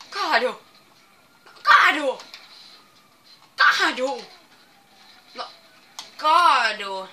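loud, punchy repeated command; shouting fall. Real, not acted. Gamer yelling at the pins to fall down. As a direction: bark each word at the screen.

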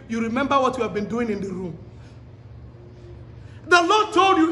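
ordinary delivery

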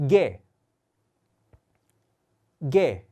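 G read as 게, not 지, firm and loud; Indonesian G said 게. Shown as a demonstration. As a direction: say 게 crisply for repeating. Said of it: "같이 따라해주세요". (인도네시아어식 G 발음 게)

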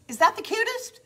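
delighted squeal showing viewers the board; gushing. Real, not acted. Admiring finished board, hushed then thrilled.

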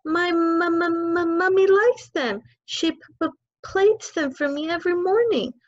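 put-on high, stammering, scared child; little girl stammering at the headmistress; frightened stutter. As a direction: stutter it out in terror. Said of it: "managed to stutter".

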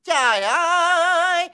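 high, drawn-out wail of disbelief; mock dismay. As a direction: stretch the cry out high and long. Kêu trời chê bài chửi. (kêu trời kéo dài, giọng cao)

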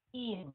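"In" falling into a held nasal N; nasal N ending. Shown as a demonstration. Teacher modelling the N sound, nasal-ending "In". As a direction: drop each "In" into the nose. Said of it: "Xuống giọng mũi luôn".

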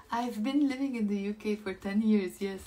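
ordinary talk, nothing marked

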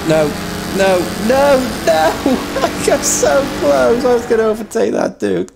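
high, dismayed wail then rueful laugh; frustrated high cries. Real, not acted. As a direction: groan at nearly making the pass. Just missed overtaking.

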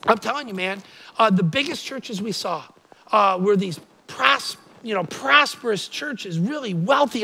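normal talking voice

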